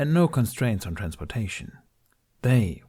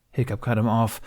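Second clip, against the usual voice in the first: plain reading voice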